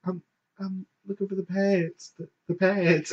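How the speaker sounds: high, soft, stammering murmur; embarrassed whisper. Retold or imitated. Mortified shopper repeats request to clerk, hushed. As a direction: keep it small and high, hoping nobody overhears. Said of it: "And I'm just whispering".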